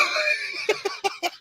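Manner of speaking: squealing laugh; high shrieking laugh. Real, not acted. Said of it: "serious as hell about that shit".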